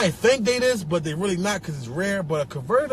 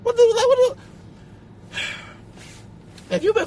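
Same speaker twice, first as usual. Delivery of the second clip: incredulous squeak; 13 semitones higher than usual. Acted. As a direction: sputter in shock. Outraged disbelief.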